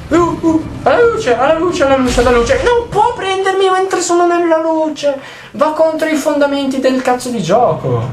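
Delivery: high, frantic pleading for the light; desperate high-pitched begging to stay in the light; real panic. Real, not acted.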